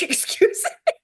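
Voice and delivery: high, laughing indignation; mock offence. Mom answering her son's shower remark, laughing mock offence. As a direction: play affronted through a laugh, pitch shooting up.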